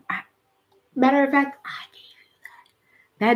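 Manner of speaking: breathy secret whisper as God. Retold or imitated. God whispering to the doubting singer, hushed. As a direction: lean in and whisper it like a divine secret.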